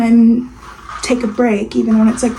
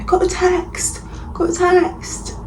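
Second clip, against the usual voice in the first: high, hammed-up British reality TV accent, slow on the last words; terrible accent; 8 semitones higher than usual. Vlogger jokingly mimics Love Island text announcement, bad British accent. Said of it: "that was a terrible accent".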